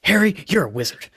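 high, fed-up sigh of a line; exasperated, offhand. Retold or imitated. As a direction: toss it off like you're sick of repeating it. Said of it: "And it's almost offhand".

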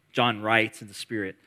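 normal talking voice

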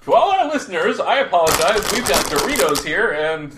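loud, high laughing mock apology; half-laughing fake apology, yelled; laughing shout. Real, not acted.

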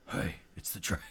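whispered dragon voice. Acted. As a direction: hush it like a sly dragon. DM starts dragon ad voice.

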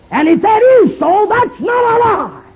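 high, slow shouted climax; preaching at full force. Acted. Preacher crying out the truth to the crowd, high and slow. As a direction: lift it to a high ringing cry.

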